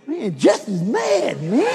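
slow, drawn-out warning; mild mock alarm. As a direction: stretch it out, half amused. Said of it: "Al said".

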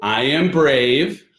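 put-on slow, deliberate affirmation lines for the child to repeat. Shown as a demonstration. Dad leading toddler through a slow morning affirmation. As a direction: lead each line slowly so she can echo. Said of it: "morning affirmation".

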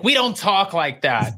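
slow, primly correcting voice; prim scolding. Retold or imitated. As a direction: imitate Heather scolding the table. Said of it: "And Heather was like".

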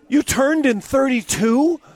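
high, slow, astonished question; amazed Lee. Retold or imitated. Lee finally noticing his partner's score, stunned. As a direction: let it dawn on him slowly. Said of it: "He goes".

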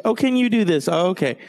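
lightly sending up a fussy homeowner; mild mock-sweet ask; mimicking the friend.

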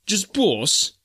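high mocking jab of a question; sneering. Sailor mocking Snipes for not digging, sneering high. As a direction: needle him with scorn. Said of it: "sneering question".

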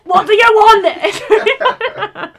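shouted, high-pitched Essex impression; Essex accent. Acting out the dubbed Notebook quarrel, shouting in Essex accent. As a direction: build to a drawn-out Essex yell. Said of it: "The Essex one's really funny".

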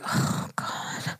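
hushed, breathy "oh God"; pained sigh. Retold or imitated. Reacting to a man asking for change, hushed and pained. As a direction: let it out softly, almost no voice. Touched reaction to a beggar's request.

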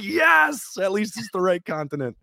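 very high, elated retold cheer; relieved cheer. Rowers hearing the ship heads to Canada, jubilant.

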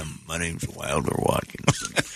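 put-on old prospector. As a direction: play a grizzled old gold miner. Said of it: "the voice that I'd do for the baby".

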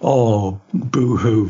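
normal talking voice

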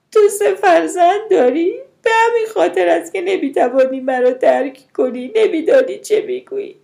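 high, loud accusing cry; anguished outburst. A narrator's reading. Mother accusing her sister of not understanding, loud anguish. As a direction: hurl it at her, distraught and shrill-pitched.

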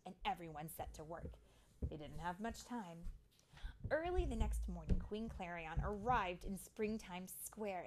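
animated storybook reading, building at the end; lively, expressive storytime voice; story narration for children. A narrator's reading. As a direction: read it to little ones, punching key words.

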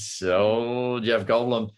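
slow, halting Jeff Goldblum; Jeff Goldblum impression. Naming his favourite impression, slowly as Jeff Goldblum. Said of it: "doing, uh, Jeff Goldblum".

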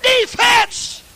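high-pitched cheering-section chant; shouted, high sideline cheer; crowd holler. Acted. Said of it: "Everybody hollered".

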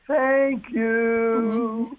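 high, drawn-out mock-sweet thank you; comically sustained, singsong gratitude; exaggerated thanks. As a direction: stretch it out high, as if thanking someone who wronged you. Thanking driver who cuts you off.

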